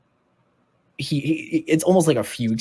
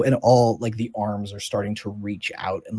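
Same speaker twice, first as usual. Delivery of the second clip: usual speaking voice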